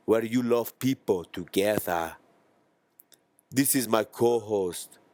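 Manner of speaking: slow African accent impression. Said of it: "two African guys".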